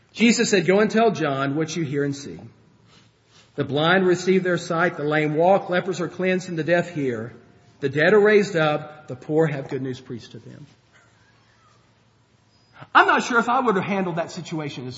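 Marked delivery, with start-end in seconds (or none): 0.6-10.6 gentle. Retold or imitated. Jesus answering John's messengers, gently and low. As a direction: speak it like a kind reply to a worried friend. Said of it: "what Jesus said was extremely gentle".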